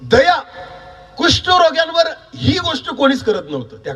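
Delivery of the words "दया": raised-voice model for the congregation; firm prompt, slightly raised; louder. Shown as a demonstration. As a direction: say it up a little, for others to repeat. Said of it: "मोठ्याने बोला".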